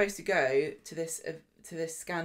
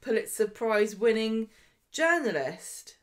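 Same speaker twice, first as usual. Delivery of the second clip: higher, drawn-out mock protest; retold indignation, lingering on the last word; indignant Bethany; 4 semitones higher than usual. Co-host voicing Bethany objecting to salon work, mock indignant. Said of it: "And Bethany's like".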